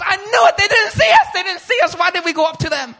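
high, loud wail of panic; acted dismay. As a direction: shout it in hammed-up despair. Acted dismay at having been wrong.

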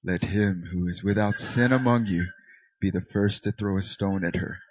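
a hint of a solemn Jesus. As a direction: speak it gently as Jesus would. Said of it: "this is always the Jesus voice".

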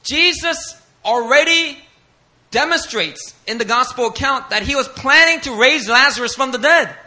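loud, high, driving sermon voice; preaching at full force. Acted.

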